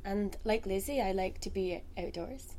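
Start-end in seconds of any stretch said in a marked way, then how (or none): none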